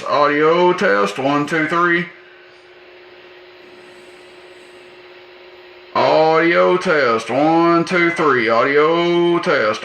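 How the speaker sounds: slow, drawn-out mic check, each word stretched; radio audio test. Real, not acted. Talking into the CB mic to show the meter, slow drawn-out test count.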